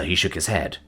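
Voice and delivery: usual speaking voice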